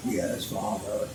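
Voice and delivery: low, very quiet reply to his father; hushed, deep-pitched obedient son; dutiful son. Acted. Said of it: "the minister says to his son".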